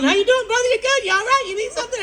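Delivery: high, loud nervous babble; frantic inner voice. Retold or imitated. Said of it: "inside my head".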